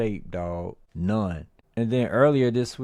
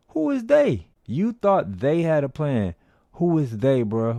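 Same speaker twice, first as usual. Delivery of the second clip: incredulous mockery; 6 semitones higher, 3 dB louder than usual. Real, not acted. Mocking a fighter's excuse.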